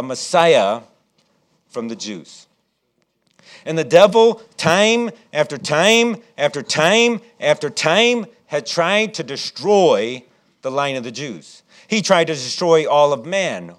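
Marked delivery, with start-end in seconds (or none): 3.6-13.7 louder, higher, slow "time after time after time"; preaching fire. Acted. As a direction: hammer the repetition like a pulpit climax. Preacher building to a climax.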